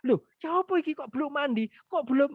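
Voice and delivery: high, exasperated nagging. Retold or imitated. Mother scolding her child again, exasperated and high. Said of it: "diomelin lagi".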